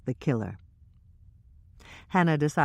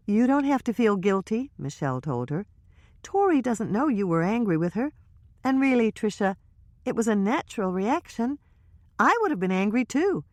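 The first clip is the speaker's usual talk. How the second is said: lightly higher, reassuring friend; Michelle's voice; 7 semitones higher than usual. Acted. Michelle reassuring Trisha, lightly voiced, kind. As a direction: comfort her gently.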